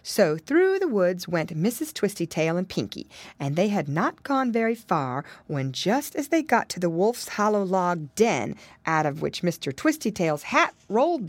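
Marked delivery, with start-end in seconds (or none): none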